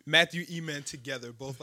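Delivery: usual speaking voice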